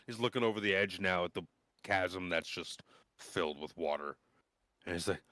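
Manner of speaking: ordinary delivery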